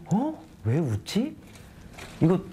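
high-pitched, baffled self-question; retold puzzled surprise. Performer wondering at the audience's laughter, mock puzzlement. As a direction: re-enact being taken aback.

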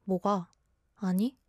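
short, sharp little retorts. Shown as a demonstration. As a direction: answer curtly, one word each. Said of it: "Why do I sound so sharp?".

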